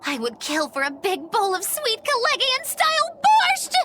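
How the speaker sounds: high, excited cry building to the last word; craving outburst. Acted. After claiming indifference to food, bursts out with excited craving. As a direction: climb in pitch and belt out the dish.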